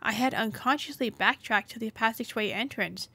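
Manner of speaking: usual speaking voice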